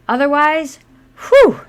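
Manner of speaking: whew. Acted. Describes pig smell.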